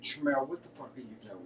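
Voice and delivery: soft self-reproach; self-scolding mutter. Retold or imitated. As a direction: scold yourself quietly under your breath. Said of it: "I was like".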